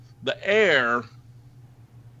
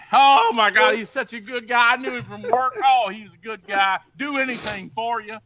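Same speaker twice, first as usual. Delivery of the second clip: mock grief; 6 semitones higher than usual. Both praising dead man.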